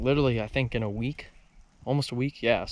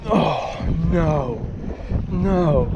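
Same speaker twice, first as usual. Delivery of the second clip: dismayed cry; 5 semitones higher, 4 dB louder, much slower than usual. Real, not acted. Losing the fish.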